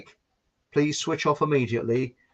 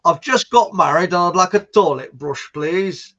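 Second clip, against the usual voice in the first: joke customer voice; 3 semitones higher, 8 dB louder than usual. Acted. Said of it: "and he says".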